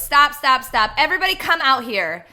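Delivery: loud commanding stop; bossy shout. Retold or imitated. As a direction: call everyone out sharply. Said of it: "the person running it goes".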